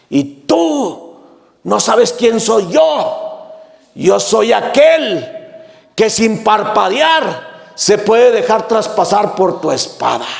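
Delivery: unshaken, gentle calm against the threat; calm, serene sage. Acted. Sage answering the furious warrior, slow and serene. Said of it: "tranquilo y sereno".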